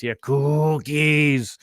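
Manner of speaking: slow, drawn-out hungry monster growl; Cookie Monster voice. Retold or imitated. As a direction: stretch out cookies like a furry monster. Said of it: "my best Cookie Monster voice".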